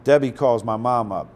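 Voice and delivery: usual speaking voice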